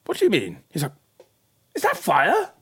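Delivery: high, incredulous God voice; acted disbelief. God hears cavemen are onto something, incredulous. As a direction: jump up in pitch, aghast.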